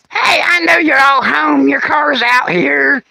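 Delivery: high, slow calling screech; Martha. Acted. Annoying neighbor calling to the hiding couple, slow high holler. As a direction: holler slowly like a maddening, uninvited neighbor. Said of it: "You've heard me do the voice".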